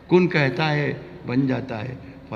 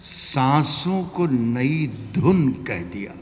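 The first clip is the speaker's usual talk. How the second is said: poetic recitation; 2 semitones higher, slower than usual. Acted. Poet recites verse.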